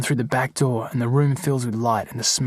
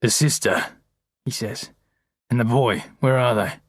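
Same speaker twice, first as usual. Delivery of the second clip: hard and angry. A narrator's reading. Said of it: "his voice is hard again and angry".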